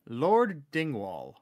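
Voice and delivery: ordinary talk, nothing marked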